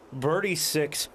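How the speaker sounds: ordinary talk, nothing marked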